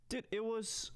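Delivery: faint, quiet mic; barely audible. Real, not acted. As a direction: voice coming through far too quiet. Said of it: "why is my voice so quiet".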